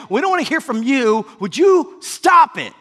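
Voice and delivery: scold, then burst out on the last words. Acted. Crowd to the rude blind man, scolding loudly.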